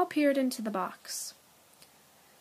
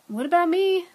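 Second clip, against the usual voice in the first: muttered complaint; 9 semitones higher, 10 dB louder than usual. A narrator's reading. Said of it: "muttered Chester".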